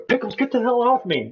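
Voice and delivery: imitating Colio yelling high at the dog; high-pitched mock shout at a biting dog. Colio yelling at his dog mid-race, a high mock yell. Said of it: "I heard Colio before in the middle of a race going".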